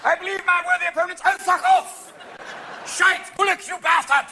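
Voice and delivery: hammed-up debater erupting into abuse; rowdy parliamentary heckling. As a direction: shout insults across the chamber in jest. Heckling Commons member mocked.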